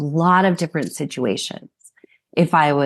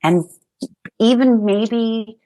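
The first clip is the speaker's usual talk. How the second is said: slow, sincere words to her younger self; tender reassurance; slower than usual. Real, not acted. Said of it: "I would say to four-year-old [name]".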